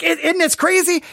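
high-pitched amazed exclamation; acting out wide-eyed excitement; excited amazement. Reader telling people about the book, excitedly amazed.

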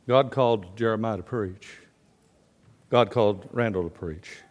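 normal talking voice

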